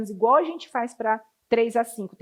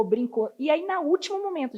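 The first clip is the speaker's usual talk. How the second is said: ordinary talk, nothing marked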